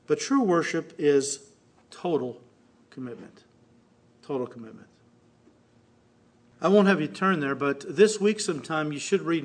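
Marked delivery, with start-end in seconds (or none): 4.2-4.9 dropped to a near whisper, low and soft; soft low echo of the key words; hushed repeat. Shown as a demonstration. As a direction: let the phrase sink in quietly.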